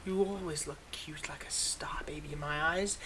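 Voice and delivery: soft, affectionate whisper; tender whisper. Acted. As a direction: confide it fondly, close to the ear. Villain fondly teases hero.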